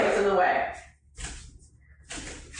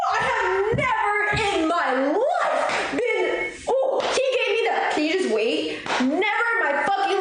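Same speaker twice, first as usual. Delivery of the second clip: outraged disbelief; 5 semitones higher than usual. Real, not acted. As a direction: cackle through the outrage at how rude he was. Outraged at the gym guy.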